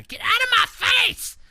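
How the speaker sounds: high shrieking yell; toddler shout. Retold or imitated. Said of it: "And so, yeah".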